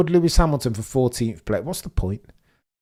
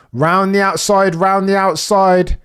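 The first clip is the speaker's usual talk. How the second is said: high, loud, drawn-out play-by-play; excited commentary; 7 semitones higher, 6 dB louder, slower than usual. Real, not acted. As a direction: stretch the words as the overtake builds. Live overtake attempt on track.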